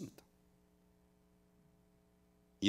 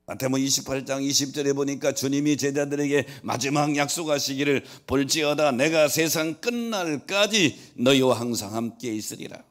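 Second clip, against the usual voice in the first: loud, raised scripture proclamation; full-force preaching; 7 semitones higher, 11 dB louder than usual. Real, not acted. Preacher proclaiming Jesus' last promise to disciples, full-force preaching. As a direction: declare the verse forcefully, easing at the end.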